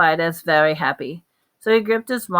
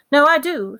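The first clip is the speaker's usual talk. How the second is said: higher, louder fierce reply; fierce insistence; 8 semitones higher, 5 dB louder than usual. A narrator's reading. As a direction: refuse to give up, firmly. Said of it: "said Harry fiercely".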